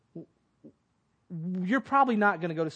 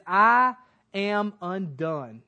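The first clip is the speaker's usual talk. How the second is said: slow solemn quote; 5 dB louder, much slower than usual. Acted. Isaiah's cry quoted in sermon.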